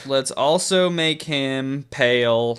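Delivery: ordinary delivery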